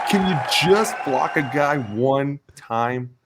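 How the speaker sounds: loud, slow, high-pitched exasperation; exasperated plea. Acted. Lineman fed up with tight ends not blocking, exasperated plea. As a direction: drag it out, begging in mock frustration.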